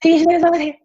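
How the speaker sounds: very high and squeaky; high nervous voice. Retold or imitated. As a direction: squeak it like a scared kid. Repeating her nervous self-introduction.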